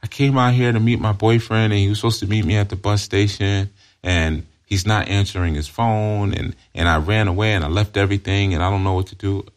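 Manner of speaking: acted distraught girl at a bus station; crying runaway. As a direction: whine out your troubles in one run-on plea. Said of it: "her sitting there on the bench crying".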